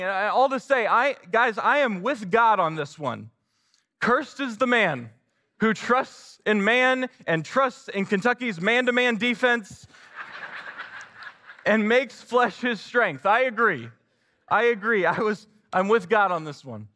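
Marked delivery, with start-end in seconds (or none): 4.0-13.2 higher, slow, solemn scripture read. Acted. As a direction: proclaim the verse slowly. Reads scripture, higher and slower.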